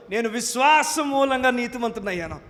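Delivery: loud proclamation stressing విశ్వాసం; bold declaration. Shown as a demonstration. Preacher showing the church how to declare it, loud. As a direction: declare it boldly, leaning on విశ్వాసం. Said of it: "నేనే చెప్తాను".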